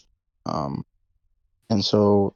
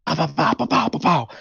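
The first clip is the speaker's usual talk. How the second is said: mock gunshots; 6 semitones higher than usual. Imitating gunfire while retelling scene.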